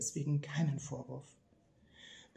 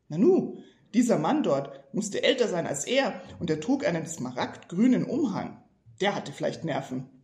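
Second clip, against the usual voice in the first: indignant; 3 semitones higher, 7 dB louder than usual. Acted. Dursley's indignant thought.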